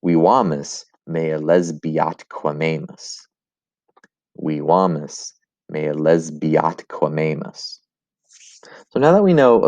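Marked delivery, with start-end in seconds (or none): 0.0-3.2 slow Latin verse with words run together; elided Latin scansion. Shown as a demonstration. Latin teacher demonstrating elision, slow, linked syllables in "lesbiatquamemus". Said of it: "gonna sound something like this". 4.4-7.8 slow, measured Latin with "lesbiatquamemus" run together; elided Latin scansion. Shown as a demonstration. Latin teacher repeating an elision example, slow, linked syllables. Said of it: "gonna sound something like this".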